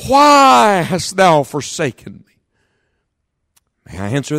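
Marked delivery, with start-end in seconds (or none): 0.1-2.3 higher, louder anguished cry fading to slow; anguished cry from the cross; Christ crying out. Acted. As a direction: cry to heaven in abandonment. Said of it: "Christ cried".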